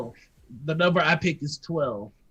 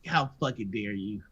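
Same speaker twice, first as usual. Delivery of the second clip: low, hushed outrage; understated offended reaction; quiet indignation; 8 semitones lower, 6 dB quieter than usual. Acted. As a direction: say it low and soft, affronted. Voicing offence at bad Chicago accents.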